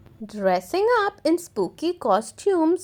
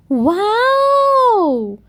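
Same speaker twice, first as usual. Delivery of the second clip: high, louder amazed wow; gasping wow; 8 semitones higher, 5 dB louder than usual. Acted. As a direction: gasp in wonder. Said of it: "gasped Peppa".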